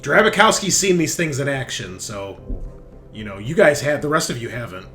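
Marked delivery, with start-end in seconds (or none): none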